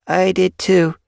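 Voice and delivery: put-on young kit voice.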